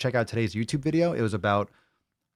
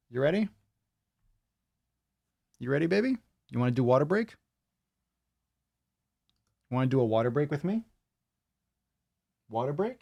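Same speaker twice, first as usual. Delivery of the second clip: higher sing-song questions, rising at the end; coaxing pet talk; 4 semitones higher, faster than usual. Real, not acted. Host asking the pup to join a water break, coaxing.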